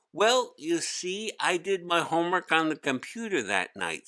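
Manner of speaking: put-on a kid groaning under a heavy load; young boy Hari. Hari making excuses.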